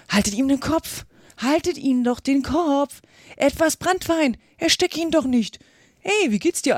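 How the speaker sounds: high, agitated calls; confused crowd voices. A narrator's reading. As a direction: voice several helpers calling out in a fluster. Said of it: "dann wieder verworrene Stimmen". (aufgeregtes, hohes Stimmengewirr)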